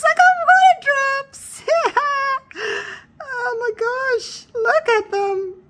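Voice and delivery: high, loud squeal of delight; drawn-out wows rising into giddy squeals; excited squeal. Real, not acted. Crafter showing viewers the water drops, squealing with excitement. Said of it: "I'm so excited".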